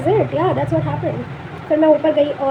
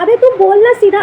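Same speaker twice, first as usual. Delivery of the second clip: loud, laughing scold; laughing outburst; 6 semitones higher, 7 dB louder than usual. Retold or imitated. The vlogger scolding her younger self, loud and laughing. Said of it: "मैं पक्का कहती".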